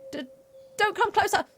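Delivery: high, loud, stammered frightened warning; shivering. A narrator's reading. Shivering package warns the player away, frightened stammer. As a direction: stammer it as a trembling creature backing off. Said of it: "talking to you while shivering".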